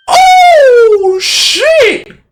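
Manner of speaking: very high, loud, drawn-out whoop; excited yell. Real, not acted. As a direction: burst out in a high squealing yell. Player reacts to new character arriving.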